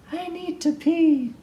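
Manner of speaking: high, small pleading guest voice; a little critter begging at bedtime; tiny whiny voice. Acted. Overnight guest nagging Bruce at night, high and small.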